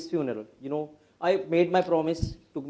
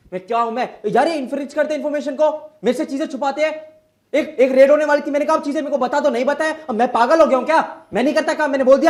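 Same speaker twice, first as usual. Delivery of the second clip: loud, high, fast venting; frustrated outburst; 7 semitones higher, 5 dB louder, much faster than usual. Acted. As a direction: let the frustration spill over. Lawyer venting to therapist. (Therapist के सामने झुंझलाकर फट पड़ना)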